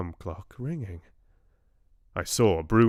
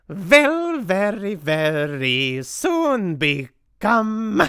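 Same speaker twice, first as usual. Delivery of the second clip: high, loud, slow sing-song rhyme; a witch cackling over her victim's fate; witch's gloating shout; 11 semitones higher, 7 dB louder, much slower than usual. A narrator's reading. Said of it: "she shouted out".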